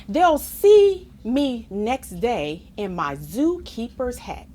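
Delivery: ordinary delivery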